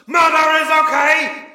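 high, shouted metal-singer title. Acted. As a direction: belt it out like an industrial frontman.

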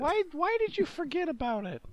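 squeaky high bewildered question; high confused voice. Acted. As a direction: ask it in a high, baffled squeak. Voicing the character's confused partner.

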